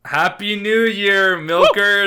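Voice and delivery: high, slow, loud greeting; excited greeting. Acted. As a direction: hype up the fans. Podcast host greeting fans.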